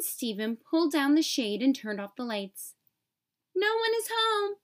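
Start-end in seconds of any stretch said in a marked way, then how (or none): 3.5-4.6 shouted. A narrator's reading. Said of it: "he shouted".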